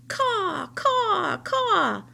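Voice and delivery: high, loud cawing crow screams; crow caws. Acted. Crow screaming caws in the rabbit's ear. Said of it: "screamed a dark message".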